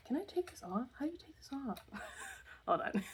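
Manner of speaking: high, hushed off-mic question; whispered aside. Real, not acted. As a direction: ask it under your breath, high. Aside about the headphones.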